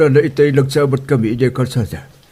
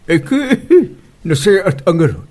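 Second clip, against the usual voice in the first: chuckling through the words; laughing. Acted. Father hearing his neighbour's gossip, laughing along. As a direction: laugh it out, tickled by the news.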